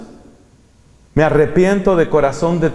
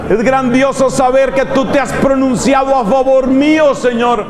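steady fervent praise over music; worship prayer; 8 semitones higher than usual. Real, not acted. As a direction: pray it aloud to the congregation. Alabanza sostenida con música. (oración de alabanza sostenida)

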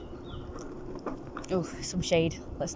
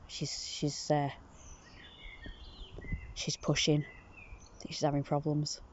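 hushed; 6 semitones lower, 11 dB quieter than usual. Real, not acted. Quiet aside about the cat.

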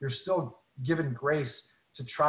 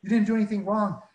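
higher, lightly voiced tearful waitress; a hint of the weeping waitress, higher; waitress reassuring; 7 semitones higher, 3 dB louder than usual. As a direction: play the waitress brushing off the apology, pitched up. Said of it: "And she goes".

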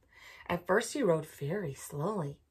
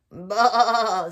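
loud sheep bleat; 3 semitones higher, 12 dB louder than usual. Acted. Sheep voiced in story.

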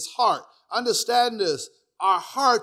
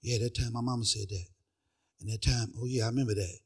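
low, soft acted brooding; brooding self-talk; 8 semitones lower, 10 dB quieter, faster than usual. Person nursing old hurts alone, low soft brooding. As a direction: sink into a self-pitying murmur. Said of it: "you meditate on it".

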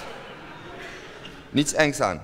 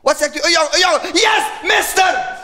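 high, loud cry of joy; joyful shout; 12 semitones higher, 4 dB louder than usual. Retold or imitated. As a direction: yell it out, overjoyed. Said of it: "shouting it's a boy".

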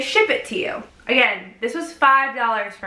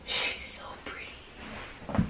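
awed whisper; 17 dB quieter, much slower than usual. Real, not acted. Vlogger gazing up at the moon at night, awed whisper. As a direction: whisper "She's so pretty" in awe, slow and breathy.

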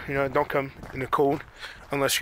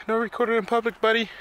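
higher-pitched pretend animal objection; lightly voiced squirrel; 7 semitones higher, 4 dB louder than usual. Squirrel telling off the person filming it, lightly voiced. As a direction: speak up high for the squirrel. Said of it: "He was telling me".

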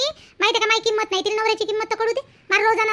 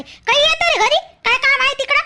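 scolding; 5 semitones higher, 4 dB louder than usual. Acted. Mother scolding son for roaming.

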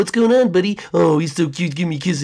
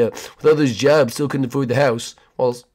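ordinary talk

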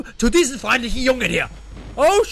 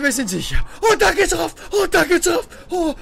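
normal talking voice

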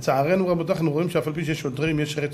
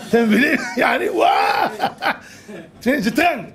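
laughing; 7 semitones higher than usual. Real, not acted. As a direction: speak through the laugh. Still laughing at joke. (צוחק תוך כדי דיבור)

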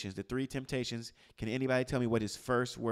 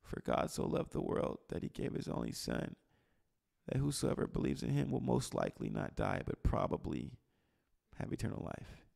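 watered-down recital; 6 dB quieter than usual. Acted. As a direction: hedge the scripture in a near-whisper. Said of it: "we would say it like this".

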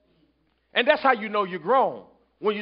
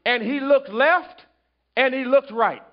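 fervent sermon cadence, loud and high; preaching; 5 semitones higher, 5 dB louder than usual. Acted. Preacher driving the point home to the church, fervent. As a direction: preach it to the congregation.

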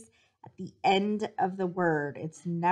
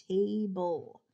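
normal talking voice

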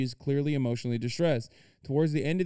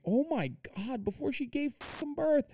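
high, breathless oh my God; stunned, voice jumping up; shocked gasp; 12 semitones higher, 4 dB quieter than usual. Real, not acted. Shocked reading article detail.

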